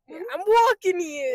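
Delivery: high, loud mock Boston accent. Joining co-host's bit, hammed-up Boston accent. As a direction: shout it like a cartoon Bostonian. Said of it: "go straight to Boston".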